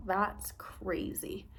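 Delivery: slow incredulous whisper; a slow whispered aside. Real, not acted. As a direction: breathe it out, half in disbelief. Aside about the Christmas note.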